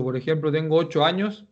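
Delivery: ordinary talk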